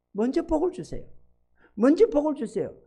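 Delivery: lightly acted high plea; high-pitched mock request; demanding believers. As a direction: mimic people begging for blessing first. Acts believers demanding blessing.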